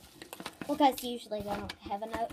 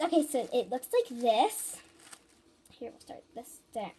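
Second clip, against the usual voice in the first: normal talking voice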